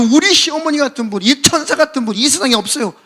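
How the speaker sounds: high, hammed-up praise; gushing put-on admiration; insincere gushing. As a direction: overpraise in a high, excited voice. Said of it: "속에도 없는 소리를 막 하게".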